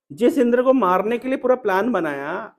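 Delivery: ordinary talk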